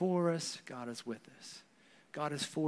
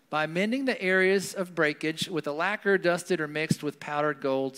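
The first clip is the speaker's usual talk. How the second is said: ordinary talk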